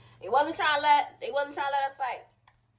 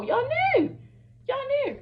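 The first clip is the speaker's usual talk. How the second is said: much higher, louder, stretched out y'all knew; high accusing squeal; 3 dB louder than usual. Real, not acted. Calling out friends who hid the plan, in a high squeal. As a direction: jab it at them in a high squeal.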